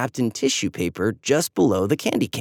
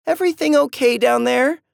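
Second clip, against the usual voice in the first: higher, louder call from upstairs; 13 semitones higher, 5 dB louder than usual. A narrator's reading. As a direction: call down the stairs to the kids. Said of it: "Mom called".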